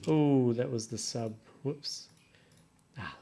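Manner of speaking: normal talking voice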